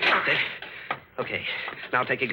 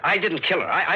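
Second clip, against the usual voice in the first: desperate denial; 8 semitones higher, 4 dB louder than usual. Acted. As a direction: voice jumps higher and louder in panic. Accused man denies killing.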